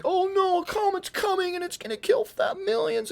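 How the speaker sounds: high, mock-panicked cartoon voice; panicked comic voice. Comic character panicking about the comet, high mock alarm. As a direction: squeal it like a cartoon doomsayer. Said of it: "And it's like".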